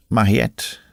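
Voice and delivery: ordinary delivery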